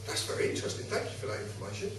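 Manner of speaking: quiet, low, smugly polite dismissal; mock politeness. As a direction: politely brush them off, softly. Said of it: "So I was being an arse".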